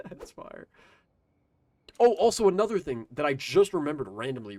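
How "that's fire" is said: laughing it out breathily. Real, not acted. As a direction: say it through a laugh. Repeats phrase through laughter.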